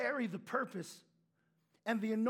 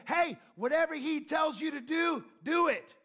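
higher, louder call to the servants; Mary calling out; 6 semitones higher, 6 dB louder than usual. Retold or imitated. Mary turning to servants, calling out instructions loudly. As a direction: command the servants boldly. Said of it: "And she said".

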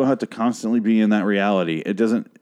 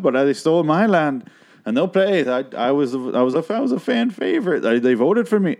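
slipping into role; 7 semitones higher, 3 dB louder than usual. Retold or imitated. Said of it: "where she'll be like".

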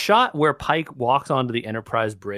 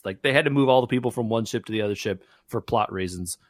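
ordinary talk

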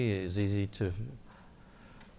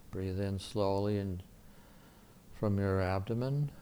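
soft, calm guided meditation voice; soft spa whisper; 5 dB quieter than usual. Acted. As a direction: gently guide them through a quiet prayer. Leads guided prayer meditation.

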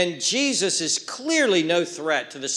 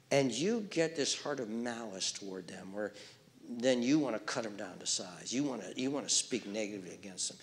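much softer, near whisper, probing the listener; hushed confiding; 5 semitones lower, 7 dB quieter than usual. Real, not acted. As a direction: draw them close and question their heart softly. Quiet reflective turn to listeners.

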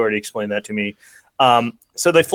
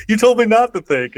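smart-aleck reply; 10 semitones higher, 4 dB louder than usual. Retold or imitated. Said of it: "when Rooster's like".

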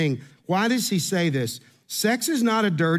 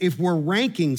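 ordinary delivery

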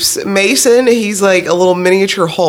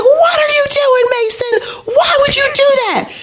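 high, wailing child's tantrum, retold; screaming little boy. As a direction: imitate an outraged kid yelling at his brother. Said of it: "screaming like he pays bills".

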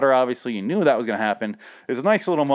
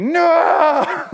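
very high, loud, drawn-out wail; over-the-top dramatic cry of despair; mock anguished scream; 7 dB louder than usual. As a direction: howl it in hammed-up horror. Mock of Rick seeing the sword.

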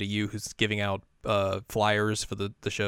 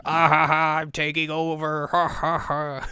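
high evil gloating laugh; villain cackle; 6 semitones higher, 6 dB louder, slower than usual. Acted. Villainous takeover, cackling character.

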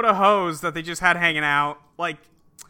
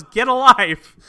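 a mock shout, louder and a bit higher; retold yell; 5 semitones higher, 8 dB louder, slower than usual. Retelling the neighbor yelling from across the way, mock shout. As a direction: throw the line back at the neighbor. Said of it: "the guy from across the way just yells".

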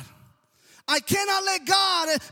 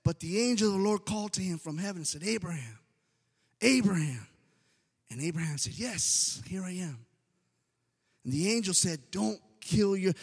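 scripture reading; 9 semitones lower, 4 dB quieter than usual. Acted. Reads scripture aloud.

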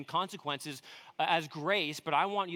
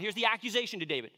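ordinary delivery

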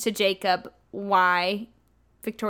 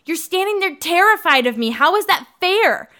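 angry retort; 7 semitones higher than usual. Retold or imitated. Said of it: "And he goes".

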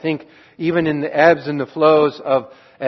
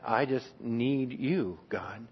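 hushed plea; 3 semitones lower, 13 dB quieter, slower than usual. Acted. Humble plea to God.

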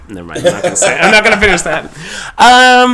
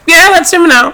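much higher, louder mocking voice; mock breezy; 14 semitones higher, 6 dB louder than usual. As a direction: chirp it like you don't care. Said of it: "it's just like".

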